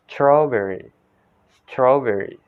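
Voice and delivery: "strawberry" said "s-traw-berry" with rounded vowel; American-style "strawberry", falling at the end; American pronunciation. Shown as a demonstration. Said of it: "in the American accent".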